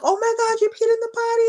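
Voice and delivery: over-the-top delighted mom; excited praise. Retold or imitated. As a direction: cheer her on like she just did something huge. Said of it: "trying to, you know, create excitement".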